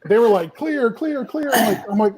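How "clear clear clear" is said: high, soft mock call-out; imitated crew call. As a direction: mimic a distant crew warning without shouting. Crew calling out, story retold.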